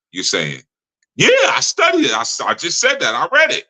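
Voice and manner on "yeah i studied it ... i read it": very high-pitched mock indignation; imitating someone offended at being questioned; indignant comeback. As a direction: protest in a squeaky high voice.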